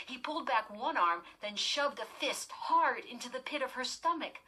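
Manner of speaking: plain reading voice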